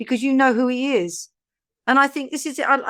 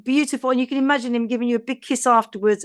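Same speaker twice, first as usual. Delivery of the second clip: usual speaking voice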